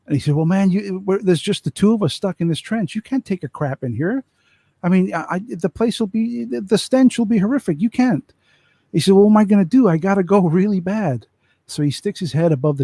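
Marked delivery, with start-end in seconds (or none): 0.3-8.3 higher-pitched, exasperated soldier voice; second soldier objecting. Retold or imitated. Soldier refuses crap in trench. 9.1-11.3 louder, higher, urgent plea; like he can't hold it any longer; desperate soldier. Retold or imitated.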